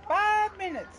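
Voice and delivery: high, loud outburst; exasperated plea. Real, not acted. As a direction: stretch it out like a fed-up parent. Echoes the parent's exasperated complaint.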